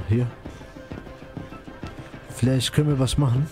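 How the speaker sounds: ordinary talk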